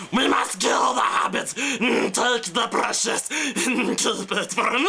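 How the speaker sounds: high, rasping hissed creature rant; high strained screech, slow at the end; pissed off Smeagol. Retold or imitated. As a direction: spit it out like a furious Gollum. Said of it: "pissed off Smeagol".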